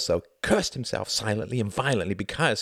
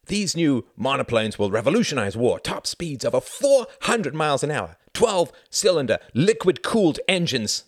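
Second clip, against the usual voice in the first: high, forceful enthusiasm; excited boasting; 5 semitones higher, 4 dB louder than usual. A narrator's reading. Pilot boasting to his comrades about engines, excited and emphatic. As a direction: sell it with emphatic gusto.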